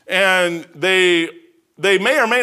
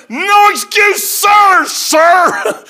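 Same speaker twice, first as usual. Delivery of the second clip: higher, louder parade-ground shout; military bark; 12 semitones higher, 7 dB louder, much slower than usual. Retold or imitated. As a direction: snap back at the captain full force. Sailor answering yelling captain.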